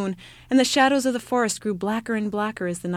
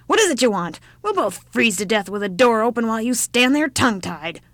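shouted; 2 semitones higher, 6 dB louder than usual. Acted. Old woman shouting at the child on her doorstep, loud. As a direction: bark it like a deaf old woman. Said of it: "shouted the old woman again".

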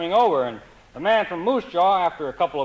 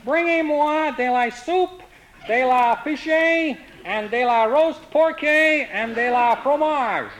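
very slow, syllable by syllable, higher; slow mock French; 8 semitones higher, slower than usual. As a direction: spell out bad French slowly to a waiter. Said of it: "spoke very slowly, syllable by syllable".

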